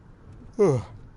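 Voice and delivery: real yawn, high; yawning mid-sentence. Real, not acted. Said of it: "I don't know why I'm yawning".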